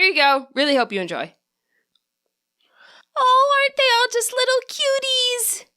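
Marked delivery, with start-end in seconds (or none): 3.2-5.6 put-on much higher, squealing girl's voice; squealing spirit voice. A narrator's reading. Spirit coos at the Happiny, squealing high. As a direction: squeal over the cute little Pokemon. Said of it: "a voice squealed".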